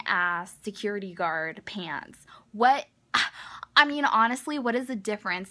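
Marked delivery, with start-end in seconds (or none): 2.5-5.5 loud, high outraged what; loud disbelief. Real, not acted. Ranting to viewers, a loud outraged disbelief. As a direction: blurt it out in disbelief. Disbelief at old man's fuss.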